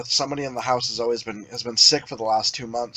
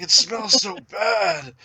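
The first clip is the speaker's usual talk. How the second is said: slow, higher imitation of a grossed-out child; mock whine, drawn out; whining kid; 5 semitones higher, 4 dB louder, much slower than usual. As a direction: stretch it into a kid's complaint. Voices son complaining about smell.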